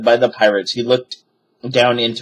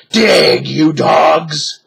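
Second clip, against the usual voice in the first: loud, higher barked order; shouted; 6 semitones higher, 6 dB louder, much slower than usual. A narrator's reading. Captain orders his pirates to dig, angry shout. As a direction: bark the order at lazy crew. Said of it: "he shouted".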